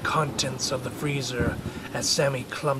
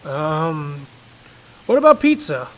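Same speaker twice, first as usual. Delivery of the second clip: higher, hopeful offer; coaxing father; 3 semitones higher, 11 dB louder than usual. Acted. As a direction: tempt the boy with pizza.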